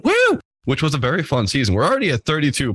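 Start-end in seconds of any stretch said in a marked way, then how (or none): 0.0-0.4 excited cheer. Real, not acted. Cheering the season's end.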